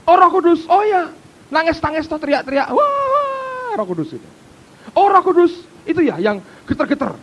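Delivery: high, loud sneering mimicry; loud scornful parody of critics; mocking scoffer. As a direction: ham up the dismissive churchgoer. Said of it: "mesti berkata".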